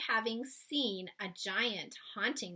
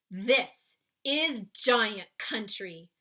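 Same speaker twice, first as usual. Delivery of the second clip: slow, louder giant announcing; 8 dB louder, much slower than usual. Acted. Giant showing Sophie the land of giants. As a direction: present it grandly. BFG voiced in read-aloud.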